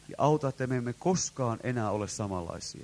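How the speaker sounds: low, soft interpreted prayer; reverent, subdued petition. Real, not acted. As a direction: speak quietly to God.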